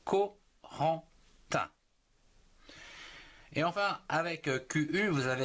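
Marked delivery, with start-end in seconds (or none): 0.0-1.7 Corentin said slowly with each syllable drawn out; slow syllabified name. Shown as a demonstration. Teacher modelling Corentin slowly for children. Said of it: "en trois syllabes".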